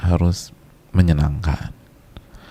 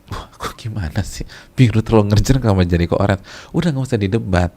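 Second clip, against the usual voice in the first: ordinary talk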